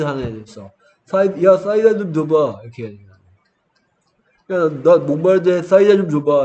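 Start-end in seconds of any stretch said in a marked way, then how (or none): 1.0-2.6 loud lisping voice. Retold or imitated. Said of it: "혀 짧은 소리 낸다". 4.5-6.4 put-on loud lisping kid. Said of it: "이렇게 하는 애들".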